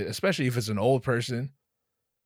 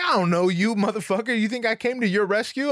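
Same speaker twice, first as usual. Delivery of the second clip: mock indignant; 9 semitones higher, 4 dB louder than usual. Mock rant at departing guy.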